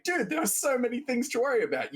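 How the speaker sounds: high-pitched mock exasperation; exasperated retort. Acted exasperated reply to Raya.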